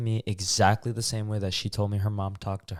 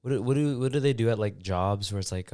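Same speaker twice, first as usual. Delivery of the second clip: ordinary talk, nothing marked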